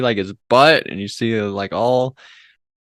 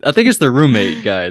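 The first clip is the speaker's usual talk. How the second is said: ordinary talk, nothing marked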